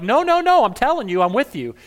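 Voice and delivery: high, loud protest; insistent protest. Acted. Peter refusing Jesus' warning, loud and high.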